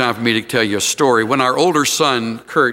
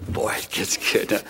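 teasing hush; 5 semitones lower, 8 dB quieter than usual. Real, not acted. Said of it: "teasing the cliffhanger".

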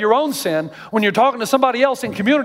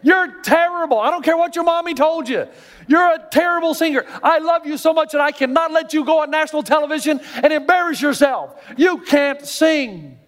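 blunt friend; 9 semitones higher than usual. Acted. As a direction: tell him straight out, pleading and firm. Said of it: "the friend that will say".

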